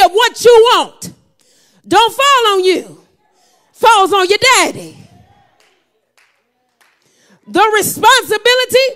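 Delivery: loud, high preacher's shout, rising in waves; whooping preacher calling the church to answer; full-force preaching. Acted. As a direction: hammer the refrain home to the congregation.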